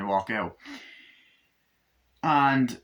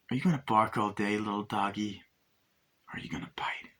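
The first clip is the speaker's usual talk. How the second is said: softer, sinking into a whisper; menacing taunt; 2 semitones lower, 5 dB quieter than usual. Retold or imitated. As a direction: taunt him, fading to a threat.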